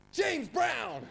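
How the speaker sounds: mock [name] shout of his own name; [name] yell. Mimicking [name] yelling his name at the audience, mock shout. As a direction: bellow your own name mid-song. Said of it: "just go".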